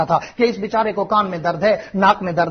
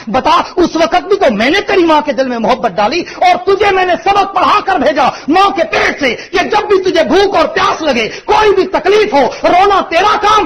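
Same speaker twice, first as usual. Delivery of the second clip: loud, high, impassioned sermon voice; full-force preacher's shout; fiery preaching; 8 semitones higher, 6 dB louder than usual. Acted. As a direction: preach it out, building to a cry.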